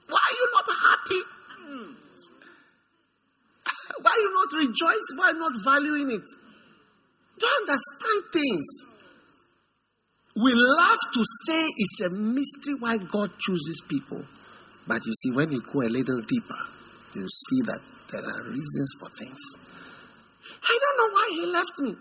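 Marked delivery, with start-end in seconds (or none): 0.1-6.3 high-pitched, pleading exasperation; exasperated questioning. Acted. Scolds someone for not rejoicing. 20.6-22.0 high-pitched mock wail; mock lament. Mimics a jilted person wailing.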